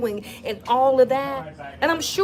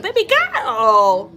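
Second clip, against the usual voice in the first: slow, drawn-out, louder "Baby girl" in a put-on voice; B Simone catchphrase; 6 dB louder, much slower than usual. Vlogger imitating B Simone's catchphrase for viewers, slow and drawn out. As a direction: stretch out "Baby girl" like her signature line. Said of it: "She's like".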